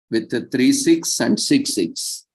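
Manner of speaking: ordinary talk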